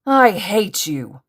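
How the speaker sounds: high, loud, drawn-out outburst; screamed hatred. A narrator's reading. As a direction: scream it slowly with hate. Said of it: "as Tomura screamed".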